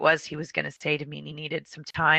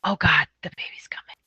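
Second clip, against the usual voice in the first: hushed whisper of alarm; imitating a nurse's whispered dismay; under the breath. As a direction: whisper it to yourself. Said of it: "she says under her breath".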